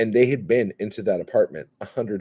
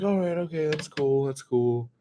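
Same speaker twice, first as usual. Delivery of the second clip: backing down; 9 semitones higher than usual. Retold or imitated. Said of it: "And I'm like".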